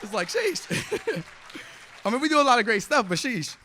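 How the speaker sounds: high laughing sheesh; nervous laughing. Real, not acted. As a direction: breathe out a giggling sheesh. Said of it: "I am so nervous".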